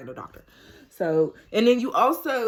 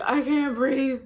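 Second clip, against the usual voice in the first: breathless plea; 7 semitones higher, 4 dB louder, slower than usual. Retold or imitated. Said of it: "I'm laying there like".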